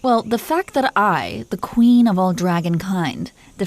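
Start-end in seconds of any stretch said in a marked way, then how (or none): none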